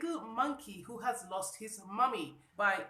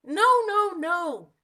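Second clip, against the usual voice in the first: high, loud no no no; loud protest; 7 semitones higher, 12 dB louder, slower than usual. Acted. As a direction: shout the denial. Monkey rejects snake.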